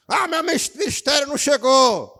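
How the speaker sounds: high, loud whining excuse; mock shouted excuse. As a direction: shout the excuse like a complaining member. Pregador imita desculpa de crente. (desculpa gritada e aguda, imitando)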